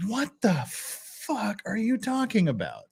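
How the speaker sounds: slow, high, drawn-out mock bafflement; put-on incredulous voice, stretching the words; imitating Marcia. Streamer voicing Marcia's reaction to the story, incredulous. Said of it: "look at Marcia go".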